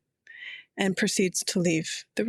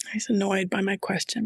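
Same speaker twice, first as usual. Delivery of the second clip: ordinary talk, nothing marked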